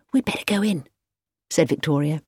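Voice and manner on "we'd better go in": whisper. A narrator's reading. Whispering before others arrive.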